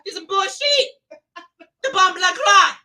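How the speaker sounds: indignant Caribbean caregiver; disgusted Caribbean nurse on cleanup duty; Caribbean nana accent. Acted. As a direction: grumble and curse in comic Caribbean accent. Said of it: "sound like she got".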